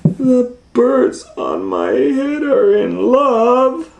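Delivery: high, slow, stretched-out disbelief; incredulous dismay. Acted. Elephant hearing the birds are in love, high slow disbelief. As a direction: drag it out high, appalled.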